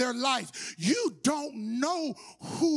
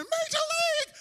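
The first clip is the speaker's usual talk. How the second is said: high excited yell of the name; crowd screaming; 14 semitones higher than usual. Retold or imitated. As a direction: scream his name from the stands. Everybody screaming at the game.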